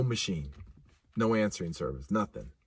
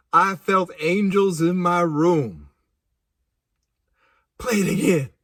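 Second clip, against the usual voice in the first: ordinary delivery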